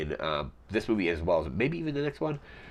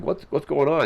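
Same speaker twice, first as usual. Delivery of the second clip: shocked question; 9 semitones higher, 5 dB louder than usual. Retold or imitated. Table stunned after beheading.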